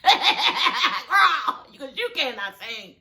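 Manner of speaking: laughing through the words. Real, not acted. Laughing at her own jab at Pam. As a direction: laugh as you say it. Laughing after mock song.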